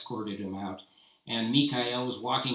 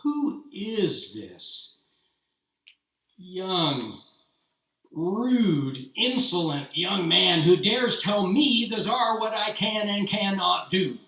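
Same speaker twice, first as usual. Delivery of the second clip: indignant Tsar; 7 semitones higher, 7 dB louder, slower than usual. Acted. Tsar insulted by outburst.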